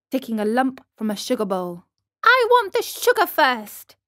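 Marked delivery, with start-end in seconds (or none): 2.2-4.0 put-on high, insistent child voice; demanding puppet boy. Puppet bargains for sugar.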